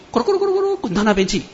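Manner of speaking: high, quick rolling noise "咕噜咕噜"; rolling sound. Retold or imitated. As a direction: rattle off the rolling sound playfully. Head rolling away, told vividly. (轻快地模仿滚动声)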